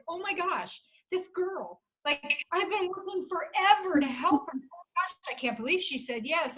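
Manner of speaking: high, gushing retelling of amazement; retold excitement. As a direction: relive the thrill, pitch lifted. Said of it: "I'm just so excited".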